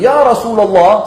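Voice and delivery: high, drawn-out shouted call. Retold or imitated. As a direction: shout the address out slowly. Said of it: "sambil teriak". (berseru sambil teriak, nada tinggi dan panjang)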